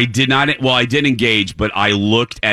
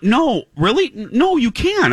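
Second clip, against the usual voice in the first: high, halting disbelief; disbelieving protest; 11 semitones higher than usual. Retold or imitated. Customer disputing the barista's answer, disbelieving. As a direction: protest it in a pitched-up, incredulous voice.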